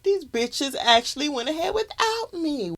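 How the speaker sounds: high, indignant mock outburst; outraged Raven. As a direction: play Raven as offended and incredulous. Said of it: "she was like".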